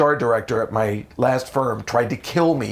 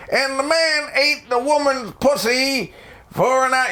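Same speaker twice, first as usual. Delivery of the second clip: much higher, slow Bill Cosby voice; Bill Cosby impression; 11 semitones higher than usual. Comedian closing his act doing Bill Cosby, slow and high. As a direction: drawl it out like Cosby telling a story. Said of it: "Bill Cosby".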